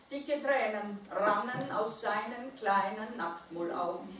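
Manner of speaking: ordinary delivery, nothing marked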